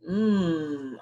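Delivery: usual speaking voice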